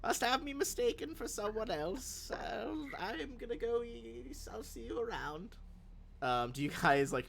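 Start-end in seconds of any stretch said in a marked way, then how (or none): 0.0-5.6 put-on high, frail old lady voice; old grandma voice. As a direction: croak it like a sweet grandma. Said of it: "like an old grandma's like".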